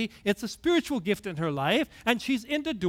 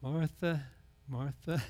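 tender Jesus; 6 semitones lower, 8 dB quieter, slower than usual. Retold or imitated. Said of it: "did you hear the heart of Jesus".

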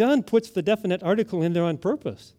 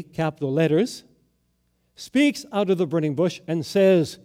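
usual speaking voice

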